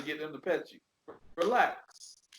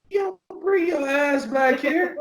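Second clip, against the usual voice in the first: calling the dog back; 9 semitones higher, 6 dB louder than usual. Retold or imitated. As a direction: yell the dog back home, pitched up.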